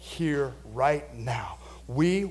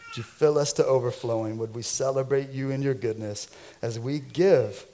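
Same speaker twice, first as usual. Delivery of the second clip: soft, low pastoral prayer; 4 semitones lower, 5 dB quieter than usual. Real, not acted. Pastor praying over the offering, soft and low. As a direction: pray gently over the gift.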